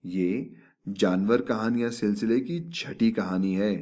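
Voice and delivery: ordinary delivery, nothing marked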